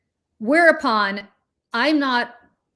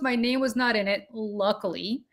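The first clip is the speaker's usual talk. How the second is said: ordinary delivery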